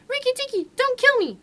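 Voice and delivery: a tiny creature begging not to be killed; almost weeping. A narrator's reading. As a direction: muskrat pleading with the mongoose. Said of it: "almost weeping".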